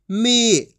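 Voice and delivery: "मी" with the ee held long; long drawn-out ee. Shown as a demonstration. Teacher answering what ma becomes, "मी" drawn out. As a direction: stretch the vowel of "मी". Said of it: "खींच के पढ़ा जाता है".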